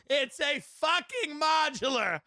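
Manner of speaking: high, slow, drawn-out yell; mock scream. Salesman screaming at the secretary off the phone, mock scream. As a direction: imitate an exasperated salesman screaming. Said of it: "But he's screaming. He's screaming".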